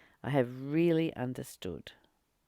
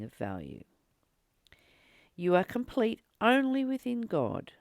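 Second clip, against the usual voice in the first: reading a passage aloud; 3 semitones higher than usual. Acted. Teacher reading a spiritual passage to the group, solemn and raised. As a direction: read it out like scripture to a hushed room.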